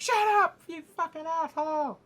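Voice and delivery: loud, high mock yell; acted angry shout. Bickering in the hall, angry shout. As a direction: shout down the other speaker. Acted quarrel, silencing the other.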